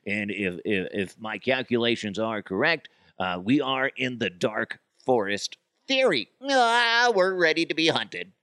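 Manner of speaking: put-on egghead voice that breaks into alarm; hammed-up scientist announcing a discovery; Scientist DeHuff. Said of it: "Then there's scientist DeHuff".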